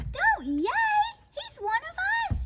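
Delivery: very high, urgent yell; yelled scolding. A narrator's reading. Pip rebukes the cheering crowd, yelling. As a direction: shush the cheering with a squeaky yell. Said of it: "yelled Pip".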